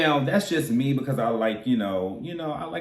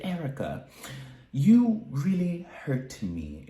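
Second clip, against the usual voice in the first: high, slow, wounded Dorit voice; Dorit impression; 5 semitones higher, slower than usual. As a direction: play Dorit, hurt and pleading. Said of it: "this is my best Dorit impression".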